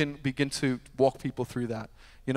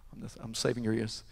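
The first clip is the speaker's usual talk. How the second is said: ordinary talk, nothing marked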